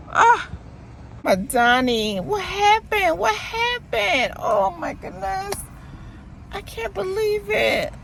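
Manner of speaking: dismayed disbelief. Real, not acted. Shocked the shop is gone.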